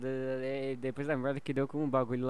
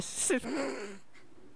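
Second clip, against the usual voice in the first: high stifled giggle; short breathy laugh; 10 semitones higher, 4 dB quieter than usual. Real, not acted. Laughing at Renan.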